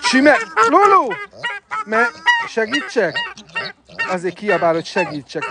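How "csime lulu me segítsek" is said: very high startled cry; shrill alarmed call. Real, not acted. Keeper calls Lulu in a very high startled shriek. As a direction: shriek the name in sudden alarm.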